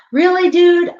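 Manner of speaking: high, loud, incredulous really dude; exasperated outburst. Retold or imitated. To the psychic, a loud, high exasperated disbelief. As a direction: blurt it out in disbelief.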